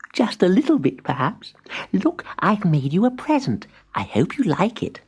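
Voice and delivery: high, small voice; a tiny friend offering a gift; lightly voiced spider. A narrator's reading. Abigail hands Timbertwig a present in a high small voice.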